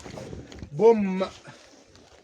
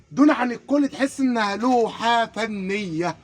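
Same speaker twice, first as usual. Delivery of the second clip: slow savoring; much slower than usual. Acted. Savoring the praise.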